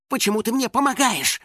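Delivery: high, puzzled question; surprised. A narrator's reading. Финик questions his rescuer, surprised. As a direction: lift the pitch in astonishment. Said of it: "удивился Финик".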